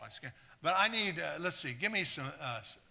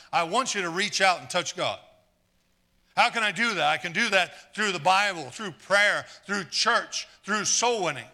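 rising preacher's call, louder and higher; preaching loudly; 3 semitones higher, 7 dB louder than usual. Real, not acted. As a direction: drive it home like a revival preacher. Preacher building to climax.

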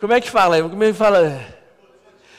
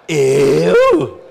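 loud, indignant "Eu?" in play; mock surprise; 3 semitones lower, 8 dB louder than usual. Husband protesting his wife's teasing, loud mock surprise. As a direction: feign outraged surprise.

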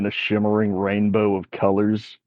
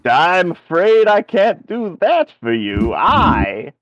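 put-on higher, self-important old wizard; pompous wizard; 7 semitones higher than usual. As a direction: announce yourself like a grand wizard. DM voices the wizard.

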